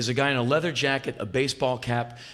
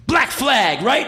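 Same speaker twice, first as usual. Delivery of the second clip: loud, high shout of recognition; 10 semitones higher, 6 dB louder, slower than usual. Retold or imitated. Rock singer recognising a punk musician, loud and high. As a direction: call out the band name like a big rock star. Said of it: "and he goes".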